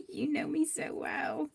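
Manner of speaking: whispered, choked you know me so well; real whisper through tears; tearful whisper. Real, not acted. Telling friends who knew she'd cry, whispered. Crying after friends' gift.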